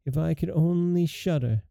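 mildly despondent storybook youth. A narrator's reading. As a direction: let the wish sink with quiet despair. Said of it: "said in the most desponding way".

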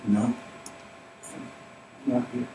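slow breathy whisper, almost no sound; still small voice. Acted. God's still small voice answering the discouraged believer, slow whisper. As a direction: barely breathe it, slow and hushed. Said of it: "that still small voice".

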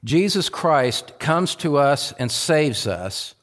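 usual speaking voice

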